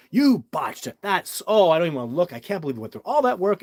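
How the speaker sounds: loud, high-pitched mock tirade; upset, imitated. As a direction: re-enact an upset colleague refusing to look. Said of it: "like, he's upset".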